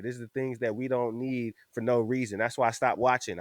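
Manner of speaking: ordinary talk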